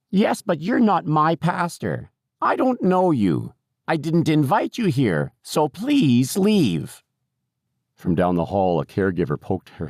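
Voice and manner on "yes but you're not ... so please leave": put-on high, slow old-lady voice; querulous resident, high and drawn out; stubborn, indignant old woman. A narrator's reading. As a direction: refuse him slowly and insistently.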